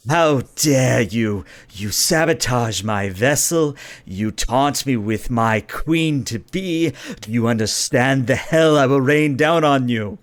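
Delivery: slow, menacing villain prince; enraged prince. Acted. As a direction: draw out each threat with seething grandeur. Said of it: "begins to puff up ... and he says".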